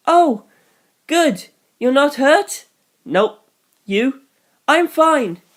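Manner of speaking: high, lively voiced teen; quick relieved check-in; relieved young man. Voicing Hirose and George.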